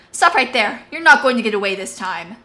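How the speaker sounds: loud stern command; commanding shout. Acted. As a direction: halt her with a bark. Tanith stops Marcia.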